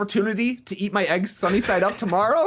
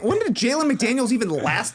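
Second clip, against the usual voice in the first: ordinary talk, nothing marked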